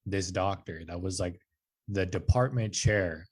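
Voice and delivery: ordinary talk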